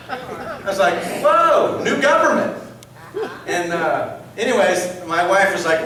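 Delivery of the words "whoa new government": high, loud mock astonishment; amazed reaction. Donor reacts to a tax surprise, loud and amazed. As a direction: exclaim it, wowed.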